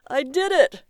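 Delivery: high, loud cry of joy; elated yell; triumphant scream. A narrator's reading. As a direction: shout it out in victory. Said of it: "Barry screamed".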